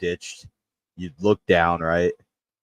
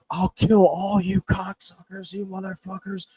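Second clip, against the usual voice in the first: furious screaming; 11 semitones higher than usual. Retold or imitated. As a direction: bellow it out into the dark yard. Neighbor's dad screams again.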